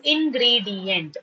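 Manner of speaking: "ingredient" slowly split as in-gree-di-ent; syllabified. Shown as a demonstration. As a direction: say "ingredient" in four measured beats. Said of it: "while syllabifying".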